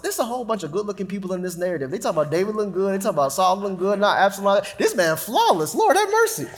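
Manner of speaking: fast, gossipy run-on thoughts ending in a squeal; rushed private musing. Retold or imitated. Preacher retelling his late-night thoughts, fast and gossipy. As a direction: rattle it off like bedtime gossip. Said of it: "I thought to myself".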